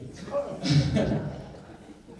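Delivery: breathy burst of laughter; amused laugh after a bit. Real, not acted. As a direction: laugh at your own joke. Laughs at own British routine.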